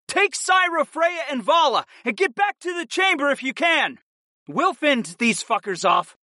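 high-pitched shout over the din; urgent yell. A narrator's reading. Fighter to lover over the shouts, yelling. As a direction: yell orders across a noisy fight. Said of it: "I yelled at my lover over the shouts".

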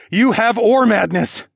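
father shouting over the gale; screamed over wind. A narrator's reading. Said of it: "I screamed over the gale".

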